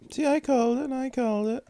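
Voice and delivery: high, gleeful sing-song told-you-so; high-pitched playful gloat; gloating singsong. Real, not acted. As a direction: crow it in a high little tune.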